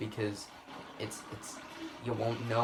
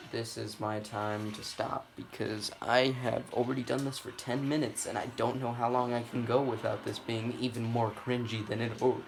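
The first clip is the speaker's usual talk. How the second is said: ordinary talk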